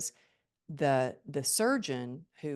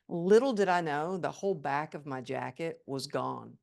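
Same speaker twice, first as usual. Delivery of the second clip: ordinary delivery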